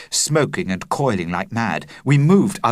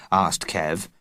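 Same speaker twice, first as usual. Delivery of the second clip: ordinary delivery, nothing marked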